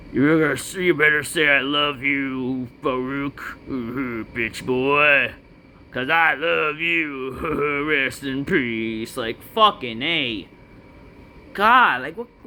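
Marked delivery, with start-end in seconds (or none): 0.1-9.2 lightly voiced slow menacing Taker; imitating the Undertaker. Vlogger imagining Undertaker's promo, slow mock menace. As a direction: drawl it out slow and ominous. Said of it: "Imagine fucking Taker says".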